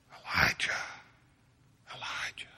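breathy unvoiced whisper of the name. Preacher to congregation, God whispering Elijah's name. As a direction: whisper it like God's still small voice. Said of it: "And he whispers to him".

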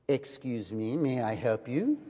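American pretending to be a polite Parisian to lost girls; posing as a local. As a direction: play the smooth helpful Frenchman.

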